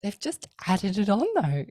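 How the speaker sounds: high, loud protest, laughing; a wife's loud laughing protest; pleading wife. Retold or imitated. To her husband, a high, loud laughing protest. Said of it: "I go".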